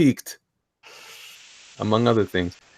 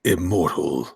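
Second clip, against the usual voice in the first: low, measured announcer word; Boyette impression; 6 semitones lower, slower than usual. Host reading the tagline as Boyette would, low impression. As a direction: intone it low and deliberate. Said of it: "my best impression of the way".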